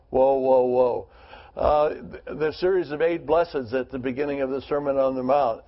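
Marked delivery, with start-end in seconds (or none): 0.1-1.1 "Woe" hit louder each time, falling; triple emphasis. Shown as a demonstration. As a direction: pound out "Woe, woe, woe". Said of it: "Triple emphasis".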